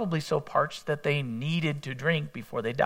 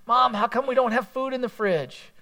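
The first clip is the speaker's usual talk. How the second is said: put-on child's voice, pitched much higher; whiny kid; 8 semitones higher than usual. Kid whining to mom about the empty fridge, high child's voice. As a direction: ask like a hungry kid nagging mom.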